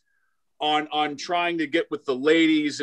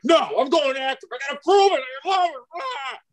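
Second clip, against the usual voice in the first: high, loud, overeager insisting; hammed-up gung-ho recruit, voice up high; eager young self; 7 semitones higher, 3 dB louder than usual. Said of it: "I'm like".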